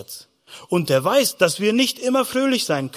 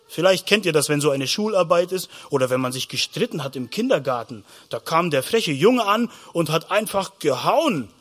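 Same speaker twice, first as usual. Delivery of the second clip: usual speaking voice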